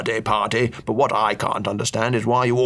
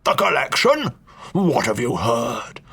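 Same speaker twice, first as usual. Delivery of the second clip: sharp, high-pitched start then slow suspicion; jolted into suspicion; startled old duke; 3 semitones higher, slower than usual. Acted. Duke startled that the stranger knows of the collection.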